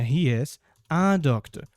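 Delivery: slow, "a" stretched before "doctor"; stress on a. Shown as a demonstration. Teacher modelling "a" before a consonant, slowly. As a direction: stress "a" in the sentence. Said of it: "Doctor começou com consoante".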